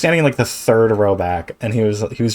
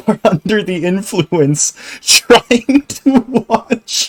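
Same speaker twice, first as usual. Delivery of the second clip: higher, slow words broken by laughter; laughing in disbelief; 8 semitones higher, slower than usual. Real, not acted. Viewer laughing at the idea of watching Nolan drunk, incredulous laughing.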